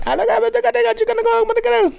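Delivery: very high, squeaky put-on voice; hammed-up storming off; mock argument. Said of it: "You just fucking".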